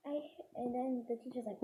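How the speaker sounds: usual speaking voice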